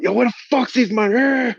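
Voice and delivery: high, slow yell through the door; mock furious shout. Banging on his door, yelling for the boyfriend. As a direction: bellow for him to come out.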